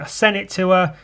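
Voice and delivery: ordinary delivery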